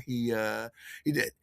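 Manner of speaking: normal talking voice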